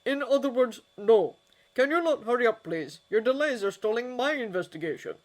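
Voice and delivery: loudly impatient, for show. A narrator's reading. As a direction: raise the voice so the whole office hears. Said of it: "in a louder voice".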